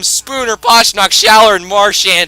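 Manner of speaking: building to a loud, high yell of triumph; excited shouting. Real, not acted. Gamer watching his team blow out the rival, triumphant shouting. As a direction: scream it as the goals pour in. Huge third period comeback.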